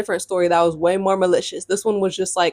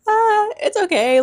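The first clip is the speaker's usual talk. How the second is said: put-on kid voice; 13 semitones higher, slower than usual. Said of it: "I'm a kid".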